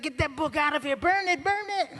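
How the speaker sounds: very high put-on wife voice; high-pitched comic hysteria; horrified wife. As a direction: squeal the order in mock panic. Voicing wife in horrified panic.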